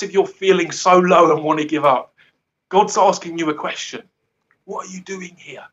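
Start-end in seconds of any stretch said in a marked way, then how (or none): 4.6-5.7 soft whisper. Shown as a demonstration. Repeats God's whispered question.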